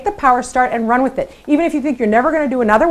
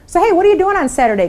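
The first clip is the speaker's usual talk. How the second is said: breezy caller; 7 semitones higher, faster than usual. Retold or imitated. Chit-chatting caller finally asks favour.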